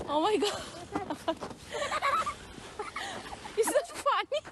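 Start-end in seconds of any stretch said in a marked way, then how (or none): 0.7-3.5 high laughter. Real, not acted. Laughing at Lily.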